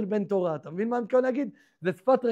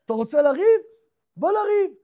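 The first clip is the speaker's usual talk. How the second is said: higher, louder picking a fight; street challenge; 7 semitones higher, 6 dB louder than usual. Acted. Nimrod challenging Esau in the forest, a hammed-up street dare. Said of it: "התחילו כמו השפה של הרחוב".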